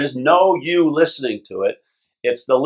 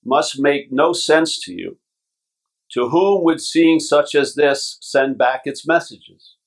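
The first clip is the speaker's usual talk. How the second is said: slow scripture reading. Acted. Reading from the Course.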